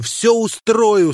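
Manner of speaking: a rush of gladness, high and loud; joyful. Acted. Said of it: "чувствуя неожиданный прилив радости".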